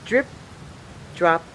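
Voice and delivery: ordinary delivery, nothing marked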